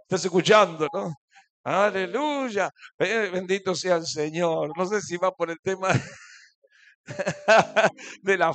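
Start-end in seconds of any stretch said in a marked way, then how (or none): 0.0-4.7 loud pulpit exclamations; preaching. Acted. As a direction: call out praise to the church. (predicando en voz alta)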